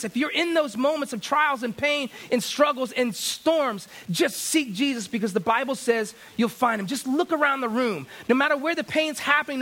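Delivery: high-pitched, driving sermon delivery; fervent preaching. Real, not acted. Preacher urging believers in pain to seek Jesus, fervent. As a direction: preach it with urgency, pitched well up.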